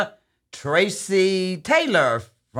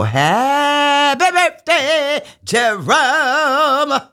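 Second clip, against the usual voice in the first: drawn-out birthday call; 8 semitones higher, 3 dB louder, much slower than usual. Real, not acted.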